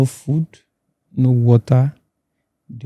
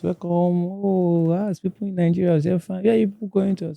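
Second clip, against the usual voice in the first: higher, softer retold greeting; imitated welcomers; 8 semitones higher, 5 dB quieter than usual. Libyan hosts greet newly arrived Nigerians, higher imitated welcome. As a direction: lift the voice into a lilting mock welcome.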